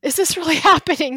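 high, loud retold disbelief with a laugh; overwhelmed disbelief. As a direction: ask it quickly, near tears in mock panic. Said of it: "my eyes start welling up".

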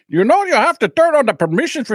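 a hint of the support guy; tech guy. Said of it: "He goes".

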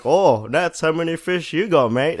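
high, loud taunting jab at a mate; mocking fishing mate. [name] teasing his fishing mate about the catch, high loud taunt.

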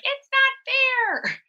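put-on high-pitched petulant little girl; childish complaint in a tiny high voice. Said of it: "this little girl voice in my head".

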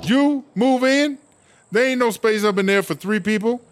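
high-pitched, stretched-out disbelief; startled protest, pitched well up; incredulous objection. Acted. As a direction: balk at the very idea. Balks at housemates moving in.

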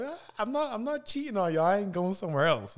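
high, pleading mock voice; playful high pleading voice. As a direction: reassure them in a high voice. Mock reassurance to listeners.

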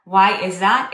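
ordinary talk, nothing marked

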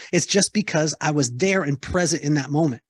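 normal talking voice

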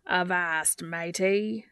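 hammy mock-pirate greeting; cheerful pirate accent. A narrator's reading. Dean answers Sam's doubt, cheerful pirate accent. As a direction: play the jolly pirate. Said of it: "Dean replied cheerfully".

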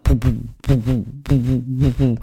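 low soft thumping "boo" beats; soft heartbeat noise for a baby; heartbeat imitation. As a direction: hum a slow heartbeat app. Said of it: "it goes like".

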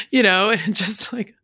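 ordinary delivery

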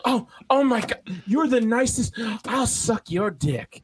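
high, slow, overcome with thanks; gushing gratitude. Retold or imitated. Homeless woman thanking a young man, gushing gratitude. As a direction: imitate an old woman overwhelmed by kindness. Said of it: "appreciative and grateful".

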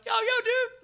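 high, loud bro-style hail; retold excited greeting. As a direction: re-enact an over-eager hello to a buddy. Upbeat greeting to the doctor, retold.

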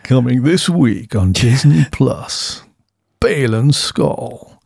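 slow, dramatic movie-trailer voice; trailer announcer. Pretend. Podcaster mock-announcing a Disney Plus show, trailer voice. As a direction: sell it like an epic show trailer.

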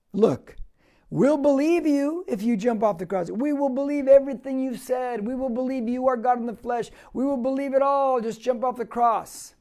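lightly voiced jeering crowd; a mild sneering dare, half-acted; mocking. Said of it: "But they're saying".

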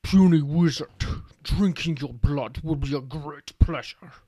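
loud, slow orc bellow; dopey cartoon bellow. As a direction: boom it out like a brute orc. Orc threatens the wizard.

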